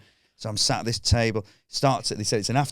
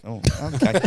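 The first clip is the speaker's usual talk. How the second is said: laughing; 5 semitones higher than usual. Retold or imitated. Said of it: "And I went".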